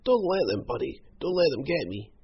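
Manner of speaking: high-pitched frightened plea; pleading, near sobbing. A narrator's reading. Croc clinging to Hal's legs, pleading tearfully. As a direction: beg for protection in a high whine.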